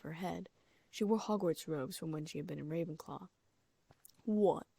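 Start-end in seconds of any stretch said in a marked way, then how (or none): 4.2-4.7 sulky. A narrator's reading. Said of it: "she asked sulkily".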